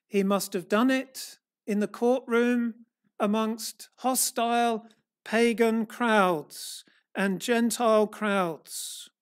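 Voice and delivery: ordinary talk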